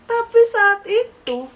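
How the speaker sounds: slow, high, loud dramatic buildup; drawn-out suspense; dramatic emphasis. Acted. Dramatic reveal in horror story.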